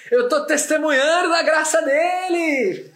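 high, loud, slow joyful proclamation, laughing; preacher-style joyful testimony; excited testimony. Real, not acted. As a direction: proclaim God's grace with joy.

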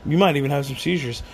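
ordinary talk

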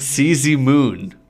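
loud, slow mock yell. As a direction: belt it out slowly as a silly cry. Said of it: "he yelled".

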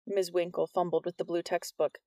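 ordinary delivery

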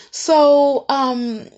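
ordinary talk

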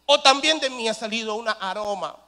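ordinary delivery

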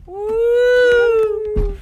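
loud high whooping cheer; excited whoop. Real, not acted. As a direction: let out a big happy woo. Celebratory whoop before leaving.

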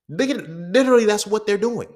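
loud, high, emphatic outburst; worked-up insistence. Real, not acted. Podcaster exposing a scam, loud and worked up. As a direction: drive the point home loudly. Heated point about the window scam.

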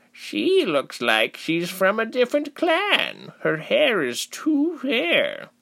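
very high, slow villager voice; doubting villager. A narrator's reading. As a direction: size the stranger up, high and drawn out. Villager sizes up the stranger.

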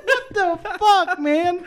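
high, loud, slow comic wail; retold indignant outburst, half laughing; mock outraged dismay. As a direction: wail it like a humiliated dad. Retelling dismay at being laughed at.

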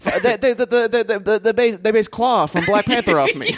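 high-pitched stammer on the opening words; stammering impression. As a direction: stutter into a mock boast, breaking into laughter. Comic stammering Biden impression.

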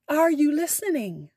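normal talking voice

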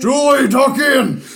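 shouting. Acted. Shouted in a put-on voice.

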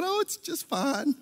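high, slow, falsely casual; breezy nonchalance. Retold or imitated. Husband on phone to wife, falsely breezy. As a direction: play it cool though in the ER.

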